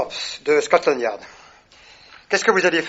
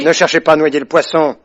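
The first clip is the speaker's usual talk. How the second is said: stern rebuke; 8 dB louder than usual. Acted. Inspecteur pressing a suspect.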